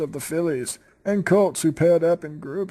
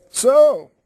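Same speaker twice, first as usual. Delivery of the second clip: loud pony voice; 7 semitones higher, 8 dB louder than usual. Acted. Character voiced in fanfic reading.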